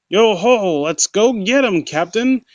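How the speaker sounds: put-on high, loud hearty pirate accent; a jolly pirate accent, all gusto. A narrator's reading. First mate Jack egging on his captain, hearty pirate accent. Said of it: "in his best hearty pirate accent".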